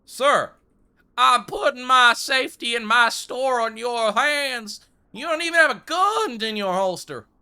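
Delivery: put-on high, loud Western-accented scolding; hammed-up outraged cowboy shout; indignant mock yell. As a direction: holler in disbelief at the useless hired gunslinger. Berates gunslinger lacking a gun.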